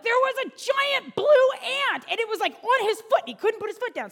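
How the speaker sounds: put-on high, loud, breathless-to-tell kid story; excited children. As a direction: imitate kids excitedly reporting something huge. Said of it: "excited about it".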